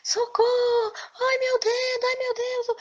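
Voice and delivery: very high, loud, frantic cries of pain; priest screaming in panic. Acted. Priest begging for help, tortoise biting his finger, screaming. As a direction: shriek like a finger is caught in a trap. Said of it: "E o padre começou a gritar".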